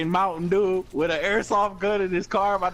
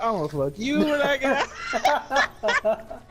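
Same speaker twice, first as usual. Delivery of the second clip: mock threat; 8 semitones higher, slower than usual. Threatening inmate voiced.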